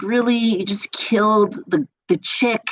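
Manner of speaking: high-pitched, incredulous retelling; upset disbelief. Retold or imitated. Viewer reacting to a Saw ending, mock upset disbelief. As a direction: re-enact your upset reaction, pitched well up. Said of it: "I was upset because I was like".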